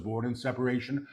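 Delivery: quick, soft undertone; hushed preview. Real, not acted. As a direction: say it softly and rapidly before proclaiming it. Quick soft take before repeating aloud.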